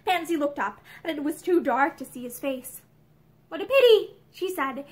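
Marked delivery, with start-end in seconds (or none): 3.5-4.2 high, louder, would-be casual remark; lightly forced nonchalance; feigned unconcern. A narrator's reading. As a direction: try hard to sound unconcerned. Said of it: "tried hard to make her voice unconcerned".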